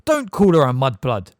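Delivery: a loud, sharp, angry rebuke; very angrily. Acted. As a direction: snap at him in outrage. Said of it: "very angrily".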